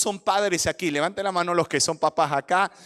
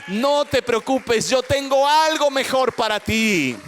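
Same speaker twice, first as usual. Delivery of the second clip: rising to a loud, fervent preaching climax; preaching at full force; 2 semitones higher, 4 dB louder than usual. Acted. Preacher pouring God's comfort over the church, full force. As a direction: preach it out, building in power.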